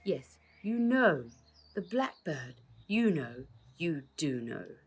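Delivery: slow, loaded hint; knowing, insistent hint; meaningfully. Acted. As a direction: lean on each word, willing her to understand. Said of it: "he said meaningfully".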